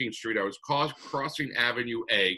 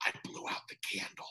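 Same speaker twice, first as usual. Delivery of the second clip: breathless hushed whisper, quick; whispered confession; 13 dB quieter, faster than usual. Retold or imitated. Wife realizes she blew out candle, whispered in shock. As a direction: hush it out in shock. Wife's stunned guilty confession.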